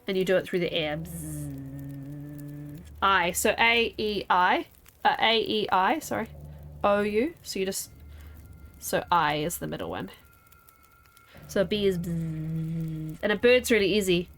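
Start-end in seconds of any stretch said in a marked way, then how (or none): 1.0-2.8 soft, low, drawn-out bzzz; imitated buzz. Shown as a demonstration. Showing how B goes through the air, soft buzz. 12.0-13.2 long, slow bzzz; imitated buzz. Shown as a demonstration. Explaining that B is a buzz, drawn-out buzzing.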